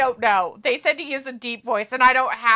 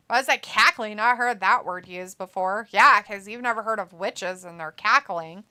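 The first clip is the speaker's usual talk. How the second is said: normal talking voice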